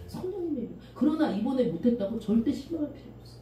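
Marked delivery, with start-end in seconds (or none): 0.1-0.7 성령님의 힘으로 repeated much softer, settling it; soft restatement. Shown as a demonstration. Pastor repeating to the children, softly and firmly. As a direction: drop 성령님의 힘으로 to a quiet, firm close. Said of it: "나의 힘으로는 어려워".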